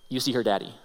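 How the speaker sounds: ordinary talk, nothing marked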